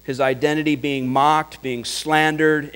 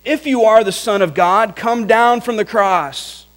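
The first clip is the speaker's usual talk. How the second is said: higher, louder jeering; mocking taunt; 5 semitones higher, 5 dB louder than usual. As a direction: taunt the man on the cross. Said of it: "saying such things as this".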